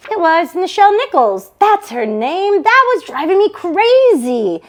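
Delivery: high, loud relieved exclaim; excited recall. Acted. Sister on the phone finally remembers the actress, excited.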